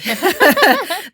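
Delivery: sudden high laugh; high burst of laughter. Real, not acted. Guest laughing at her childhood culture shock, high loud laugh.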